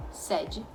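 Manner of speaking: "sede" with an open E, like sé-de; "sede" with open é, the seat or headquarters; open e sound. Shown as a demonstration. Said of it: "Uma eu falo como se tivesse um acento".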